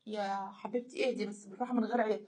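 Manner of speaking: low, hushed calming voice; soothing husband. Retold or imitated. Husband calming his crying wife, soft and soothing. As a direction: softly talk a crying person down.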